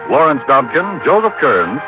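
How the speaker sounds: ordinary delivery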